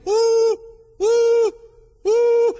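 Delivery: loud, very high train whistle; piercing imitation whistle; shrill engine scream. A narrator's reading. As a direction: hoot shrilly like a steam engine. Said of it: "a shrill scream from the engine".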